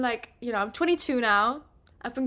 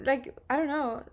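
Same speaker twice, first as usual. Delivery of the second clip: normal talking voice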